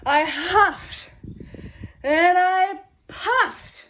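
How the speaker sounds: slow, loud and rising huff; building huff. Acted. Wolf winding up a sneeze at the stick house, slow and loud. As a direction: drag out the puffed like a gust.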